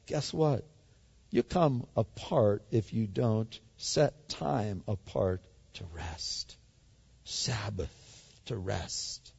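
hushed whisper, slowing down. Real, not acted. Preacher whispering to the congregation about Sabbath rest. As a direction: drop to a whisper to drive it home. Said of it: "whispered point on rest".

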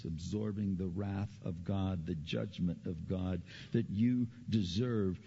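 ordinary talk, nothing marked

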